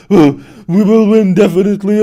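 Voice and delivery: loud, hammed-up gloating chatter voice; mock the cocky opponent, blown up and loud; mocking imitation. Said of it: "He's like".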